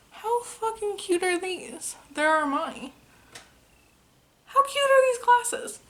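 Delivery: high-pitched excited squeal; gushing. Real, not acted. Vlogger showing off new glasses to viewers, gushing in a high squeal.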